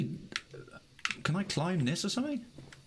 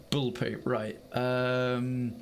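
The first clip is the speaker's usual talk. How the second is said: ordinary talk, nothing marked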